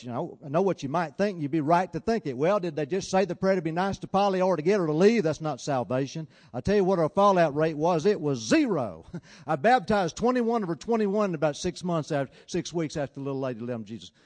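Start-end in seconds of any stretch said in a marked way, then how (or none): 0.0-11.3 louder, higher, pressing the point hard; rising preacher's fervor, then a firm landing on zero; full-force preaching. Acted. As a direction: preach it to the back row, building.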